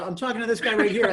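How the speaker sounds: high, laughing, rushed excuse; nervous explaining. Retold or imitated. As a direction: talk your way out of trouble, giggling. Explaining to Tyson, retold.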